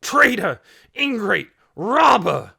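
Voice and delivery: loud, high, furious shouts; screamed insults. A narrator's reading. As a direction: hurl each insult separately at full volume. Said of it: "he screamed".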